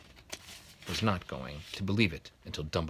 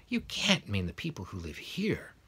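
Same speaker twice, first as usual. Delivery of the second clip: ordinary delivery, nothing marked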